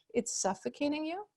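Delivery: very soft, gentle questioning echo; sweet deference. Shown as a demonstration. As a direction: mirror their words back sweetly as a question. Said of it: "sweetly, with that little tone of deference".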